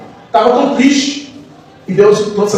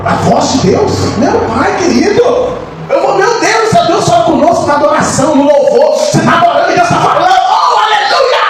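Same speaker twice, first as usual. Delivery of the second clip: ecstatic preaching; 7 semitones higher than usual. Real, not acted. Preacher exults to the congregation in God's voice, ecstatic. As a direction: preach up to a soaring hallelujah.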